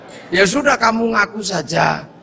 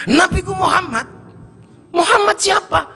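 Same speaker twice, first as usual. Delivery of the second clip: pitched up, insistent answer; high indignant reply; 11 semitones higher than usual. Acted. Villager insists his prophet is Muhammad, higher.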